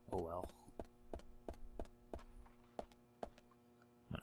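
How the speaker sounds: ordinary talk, nothing marked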